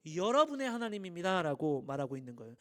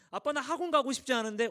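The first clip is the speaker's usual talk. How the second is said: imitating a child whining, higher and louder; whiny child; 6 semitones higher, 5 dB louder than usual. Kid whining to dad about skipping class, higher and louder. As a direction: plead like a kid who wants to skip class.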